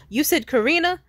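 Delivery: loud, high checking the name; loud question. Retold or imitated. Creep at the corner store, loud question. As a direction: call out to confirm. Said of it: "He said".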